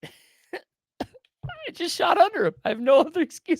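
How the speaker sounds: high, slow, laughing admission. Real, not acted. Hunter admitting to his co-host that he missed, laughing. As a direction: laugh at yourself helplessly.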